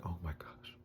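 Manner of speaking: hushed, breath-filled whisper; soft retold gasp of relief. Retold relief that Spider lives.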